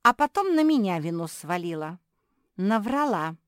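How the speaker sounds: loud, indignant tattling; blurted accusation. A narrator's reading. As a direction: blurt out the blame loudly. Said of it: "выпалила Куки".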